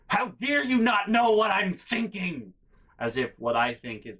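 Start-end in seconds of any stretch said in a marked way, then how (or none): 0.1-2.5 high, slow mock-outraged yell; infuriated family. Family infuriated by a question, mock outrage. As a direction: imitate relatives enraged at being asked. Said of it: "the asking infuriates my family".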